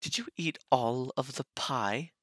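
high British mom voice; soft British accent. Acted. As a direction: question your child in soft British tones. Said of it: "It was more of a soft British accent".

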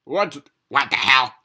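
high, loud startled cry; pizza's loud startled outburst; shocked pizza. Acted. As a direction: yelp it in shock. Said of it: "said the pizza".

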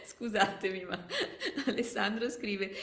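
loud, amused apology; real laugh breaking into the words; laughing aside. Real, not acted. Reader breaks off laughing at chat.

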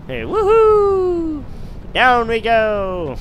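loud, high, long-drawn whooping; exuberant whoop. Pretend. Gamer jumping down, exuberant whoop. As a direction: stretch it out like a thrill ride.

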